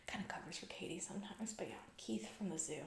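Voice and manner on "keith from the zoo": normal talking voice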